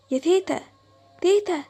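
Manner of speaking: loud alarmed call. Acted. Girl calling her grandmother who just disappeared, loud. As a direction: shout for her as she vanishes.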